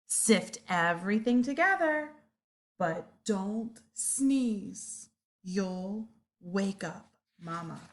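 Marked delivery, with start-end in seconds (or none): 2.8-7.8 drawn out, slow hushed warning; slow softened caution. Acted. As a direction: stretch it out slowly. Warning not to wake Mama.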